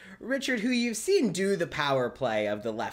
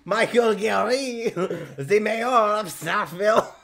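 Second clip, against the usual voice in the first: NPC voice; 5 semitones higher, slower than usual. Acted. GM voices NPC introducing himself.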